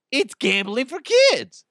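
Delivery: high, indignant outburst; mock outrage. Podcast host interrupting his arcade story with a high, outraged outburst. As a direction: blurt it out high, as if scandalized.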